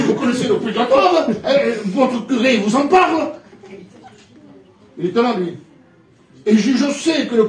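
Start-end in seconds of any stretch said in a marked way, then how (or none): 0.0-3.3 louder, rising street preaching. Retold or imitated. Preacher challenging the neighbour before the crowd, preaching loud. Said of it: "je prêchais".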